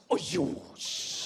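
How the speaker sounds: unvoiced hiss of disgust; disgusted Chinese elder. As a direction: clear the air like smoke in the room, a mock Chinese elder. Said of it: "When you disappoint a Chinese person".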